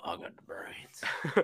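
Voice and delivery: slow, low creepy whisper; mock Freddy whisper. Friend echoing Freddy, slow creepy whisper. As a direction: drag it out like a villain.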